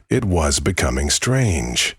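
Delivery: ordinary delivery, nothing marked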